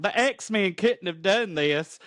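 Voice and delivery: hammed-up Southern accent for Rogue. Rogue finding a room of dead bodies, drawn-out Southern accent. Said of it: "That's the voice I chose for Rogue".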